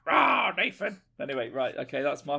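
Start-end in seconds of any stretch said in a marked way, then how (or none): 0.1-1.0 high, loud playful roar; Battle Cat roar. Acted. Owner making his toy look at Nathan, playful Battle Cat roar. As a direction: roar the name like a cartoon big cat. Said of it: "He's looking at you".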